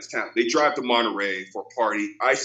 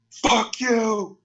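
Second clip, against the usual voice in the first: higher mock yell at the kids; imitated angry driver; 9 semitones higher, much slower than usual. Retelling the egged driver cursing, mock angry yell. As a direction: play the pissed-off driver, raised. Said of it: "He was like".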